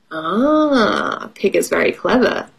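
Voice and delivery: ordinary talk, nothing marked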